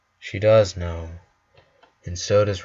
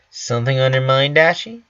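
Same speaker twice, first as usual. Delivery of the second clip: high, fast bubbly Pinkie voice, louder; curious quip; 5 semitones higher, 5 dB louder, faster than usual. Acted. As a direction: tease her with a quick curious lilt. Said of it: "she quips curiously".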